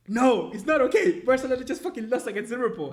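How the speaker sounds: screaming at the security guard about the defeat; retold furious shout. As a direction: yell it at the guard in despair. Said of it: "Why are you shouting?".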